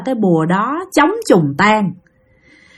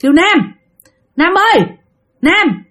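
higher, drawn-up name call in the night; retold eerie summons by name; man's voice calling; 8 semitones higher than usual. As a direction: imitate someone calling a name clearly, pitched up. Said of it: "tiếng có người kêu bạn mà giọng đàn ông".

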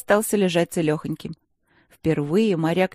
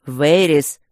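loud, menacing barked name; 2 semitones lower, 8 dB louder than usual. Acted. Rector barks the girl's name, loud and menacing. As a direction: bark her name to scare her into obeying. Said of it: "устрашающе громко рявкнул".

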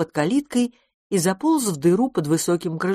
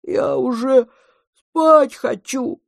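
high, loud, stretched-out yawny words; sleepy monster, vowels drawn wide; yawning; 8 semitones higher, 5 dB louder, slower than usual. A narrator's reading. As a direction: talk through a big childish yawn. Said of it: "и зевнуло во всю свою".

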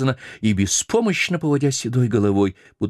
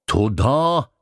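solemn; 5 semitones higher than usual. Acted. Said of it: "ответила овчина торжественно".

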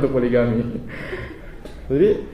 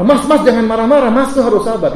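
nagging wife; 6 semitones higher, 4 dB louder than usual. Retold or imitated. Wife scolding husband.

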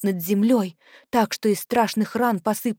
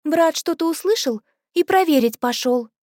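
put-on girl's voice; 7 semitones higher, 3 dB louder than usual. Girl voiced in story.

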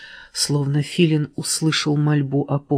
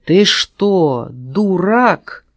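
scornful; 4 semitones higher, 5 dB louder, much slower than usual. A narrator's reading. Frog snaps at fox.

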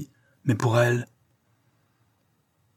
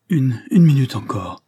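urgent raised plea; pleading; 4 semitones higher, 6 dB louder than usual. A narrator's reading. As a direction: beg for one more minute. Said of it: "supplie Liane".

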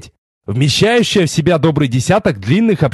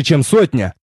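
ordinary delivery

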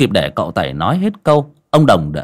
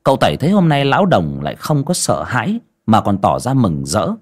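plain reading voice

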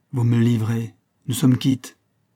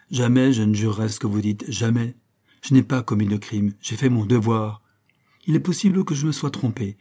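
ordinary delivery

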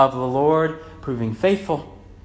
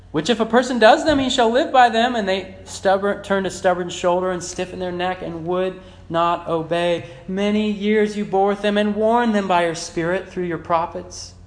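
raised, emphatic pulpit reading; forceful scripture reading; 7 semitones higher, 4 dB louder than usual. Real, not acted. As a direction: read the verse out with high, driving emphasis. Reads scripture aloud forcefully.

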